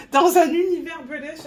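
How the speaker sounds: petit rire amusé, voix plus haute; en riant. Real, not acted. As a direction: rire en évoquant ce décalage. Amusée par l'univers british. (rire amusé, un peu plus haut et plus fort)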